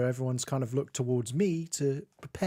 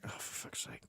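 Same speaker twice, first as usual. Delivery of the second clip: whispered exasperation; 19 dB quieter than usual. Real, not acted. Muttered exasperation after family demands.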